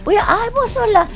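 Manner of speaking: high, eager fan voice; a touch of fan excitement; mock excited recognition. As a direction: squeal like a fan who spots her.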